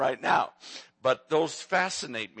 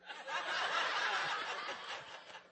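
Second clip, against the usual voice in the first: high breathy laugh; 10 dB quieter than usual. Real, not acted. Laughing at grandson story.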